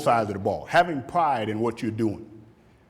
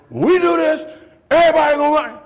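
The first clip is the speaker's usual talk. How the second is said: loud, higher barked command to the team; coach's order; 13 semitones higher, 8 dB louder, faster than usual. Retold or imitated. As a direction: lay down the rule loudly. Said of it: "But when the coach says".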